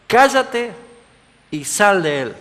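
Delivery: sharp, high, loud command; stern rebuke. Acted. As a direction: rebuke the spirit with full authority. Jesus rebukes the spirit.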